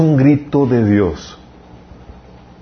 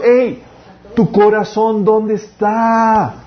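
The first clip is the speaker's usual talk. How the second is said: higher, urgent call, stretching the last question; God calling out; 8 semitones higher, much slower than usual. Acted. Preacher voicing God asking the congregation, higher and pleading.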